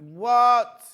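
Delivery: shocked what. Retold or imitated. Shocked by shipping cost.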